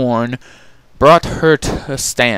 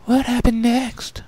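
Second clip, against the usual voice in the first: gentle shy pony; 13 semitones higher than usual. Acted. Fluttershy asking Rarity, high and gentle. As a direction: coax the story on. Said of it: "Fluttershy asked".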